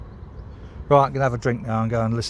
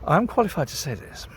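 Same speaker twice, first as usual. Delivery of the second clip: lightly voiced posh English accent, a touch higher; a hint of a posh English accent, for government advisers; 5 semitones higher than usual. Shown as a demonstration. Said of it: "I can put on my posh voice".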